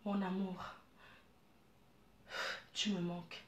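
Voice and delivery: a lover's soft murmur in bed; soft sweet voice. Shown as a demonstration. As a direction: breathe it to your lover, gently. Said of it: "avec une voix douce".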